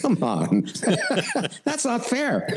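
laughing disbelief. Retold or imitated. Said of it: "I thought".

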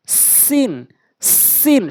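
সিন with a hard, strong hissed s; সিন, tongue tip at the front teeth, said with force; forceful seen. Shown as a demonstration. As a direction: press firmly on the s of সিন. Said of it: "শক্তি দিয়ে, শক্ত করে".

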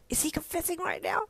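high-pitched, disbelieving aside; startled thought. A narrator's reading. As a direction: squeak it like a stunned thought to yourself. Startled inner thought during confession.

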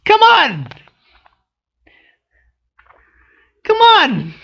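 high, loud cry of disbelief; exasperated protest. Real, not acted. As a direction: throw your hands up: come on!. Frustrated at the comic's plot.

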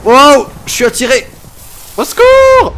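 hammed-up cry for help; mock panic. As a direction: shriek as you get sucked in. Pulled in by trap.

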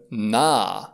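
"na" with a long drawn-out a, silent h; long vowel. Shown as a demonstration. Teacher gives an example of silent H, "na" with a long vowel. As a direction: stretch "na" into "naaa". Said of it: "această vocală este lungă".

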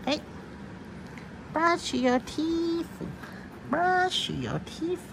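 very high coaxing voice; high singsong. Acted. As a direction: coax the cat up high. High playful command to cat.

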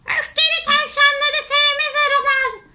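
high, loud little bee voice; high-pitched cartoon bee. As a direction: squeak it out like a scolding bee. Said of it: "Arılardan kimi dedi ki".

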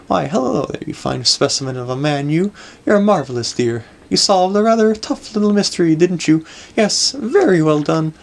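lilting, flattering lady voice; gushing grand lady. Acted. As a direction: lay on the admiration like a grand dame.

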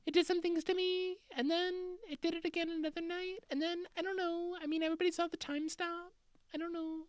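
very high, soft, hesitant mock voice; unsure American witness. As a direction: hem and haw in a tiny falsetto. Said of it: "Americans are all just like".

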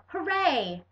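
high, playful hooray; shouted cheer. A narrator's reading. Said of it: "Each Saturday I shout".

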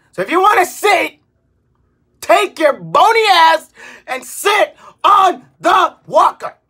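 ordinary talk, nothing marked

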